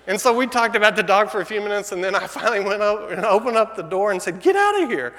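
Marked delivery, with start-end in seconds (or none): 4.4-5.1 much higher and louder, a sharp shoo; shooing shout. Retold or imitated. Husband shooing the strange dog out, loud high shout. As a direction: chase the dog out the door. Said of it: "like that".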